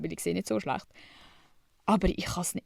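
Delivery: ordinary talk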